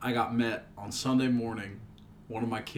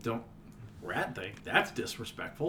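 whispered; 3 semitones lower, 8 dB quieter than usual. Acted. Offended about rat thing.

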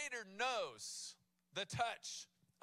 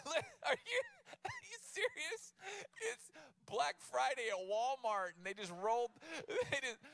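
much higher, incredulous, laughing mockery; exasperated disciples; 6 semitones higher, 3 dB louder than usual. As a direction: scoff at Jesus like he's lost his mind. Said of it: "let me give you some human emotion to this".

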